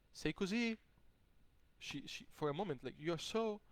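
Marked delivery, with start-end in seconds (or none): 0.1-0.8 high, wondering Italian exclamation; woman speaking Italian. Retold or imitated. Woman admiring him in Italian, high and wondering. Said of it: "she says in Italian". 3.0-3.6 high, rising, unfinished phrase; searching for the word. Retold or imitated. Woman looking for the word to say, high and suspended. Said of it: "she's looking for the word to say".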